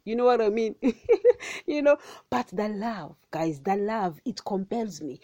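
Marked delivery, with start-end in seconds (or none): 0.8-1.6 a sudden high laugh at her own joke; high burst of laughter. Real, not acted. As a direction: let a high laugh break out.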